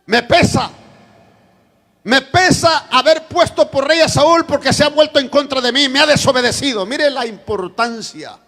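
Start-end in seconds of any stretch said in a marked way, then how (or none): 0.1-6.8 loud, high preaching of God's lament; preaching God's words. Acted. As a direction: proclaim God's regret to the congregation. (predicando con fuerza)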